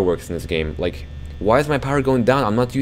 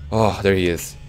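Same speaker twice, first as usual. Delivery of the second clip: ordinary talk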